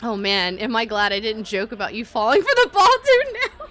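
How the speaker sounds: ordinary talk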